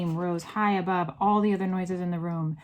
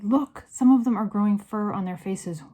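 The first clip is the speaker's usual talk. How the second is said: raised voice, urgently pointing it out; excited cry; 3 semitones higher, 7 dB louder than usual. A narrator's reading. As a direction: call out in astonishment. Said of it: "I cried".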